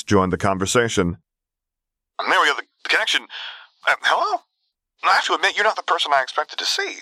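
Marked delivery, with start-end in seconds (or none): 2.2-7.0 put-on high, light young caller's voice; polite caller, pitched well up; Luke. A narrator's reading. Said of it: "A familiar voice sounded distantly over the comm".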